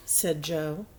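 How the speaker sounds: plain reading voice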